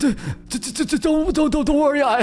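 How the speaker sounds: high-pitched, stuttering mock panic; squeaky pretend terror, tripping over the first word; scaredy-cat stammer. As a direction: stammer it out like a hammed-up coward. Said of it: "the two scaredy cats".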